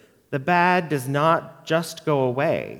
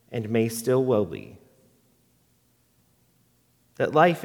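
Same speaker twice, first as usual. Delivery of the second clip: poem reading. Acted. Pastor reading a poem to the congregation, measured and gentle. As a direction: let each line land gently.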